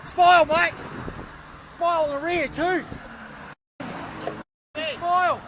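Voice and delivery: loud sarcastic smile mate; real loud taunting; mocking shout. Real, not acted. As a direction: taunt the driver for the camera. Mocking driver for camera.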